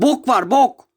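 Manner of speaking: angry outburst. Real, not acted. Frustrated at knocking at door.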